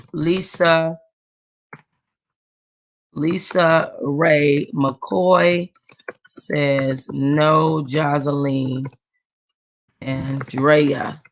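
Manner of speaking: words drawn out one by one while typing; slow dictation. Real, not acted. To viewers while editing the video, slow dictation. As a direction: sound each name out slowly as you type it.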